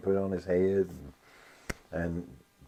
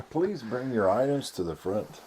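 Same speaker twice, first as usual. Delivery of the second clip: store announcement; 6 semitones higher, 5 dB louder than usual. Retold or imitated. Joins mimicking store announcement.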